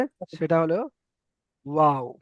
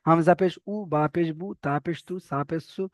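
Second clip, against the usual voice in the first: running recitation; 4 dB quieter than usual. Shown as a demonstration. Teacher runs through the pesh list quickly, without stretching. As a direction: rattle through the spellings unstretched. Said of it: "আমি একটু running বলে দিলাম".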